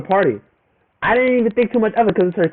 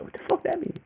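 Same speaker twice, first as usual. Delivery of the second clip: hushed baffled whisper; 12 semitones higher, 12 dB quieter than usual. Retold or imitated. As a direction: whisper "What the fuck that mean?" in disbelief. Confused by the brother's hint.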